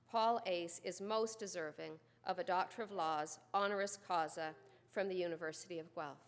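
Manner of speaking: ordinary talk, nothing marked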